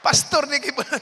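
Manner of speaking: said high and loud through laughter; laughing. Real, not acted. Telling the crowd about the Kibuloy pastors, laughing loud. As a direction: laugh the words out in disbelief.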